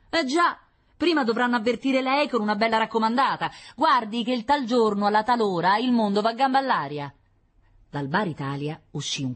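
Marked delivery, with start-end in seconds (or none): 0.1-7.1 higher, louder mocking retort; sneering Roman banter; sarcastic neighbour. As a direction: rub the sarcasm in.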